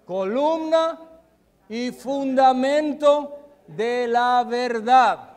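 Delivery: loud, high, drawn-out proclamation; emphatic recital. Real, not acted. Preacher prompting the congregation to finish the verse, emphatic recital. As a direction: ring out each phrase slowly.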